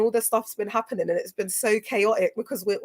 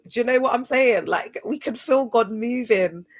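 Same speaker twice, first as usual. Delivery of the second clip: ordinary talk, nothing marked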